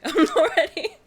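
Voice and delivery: laughing. Real, not acted. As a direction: giggle it out excitedly.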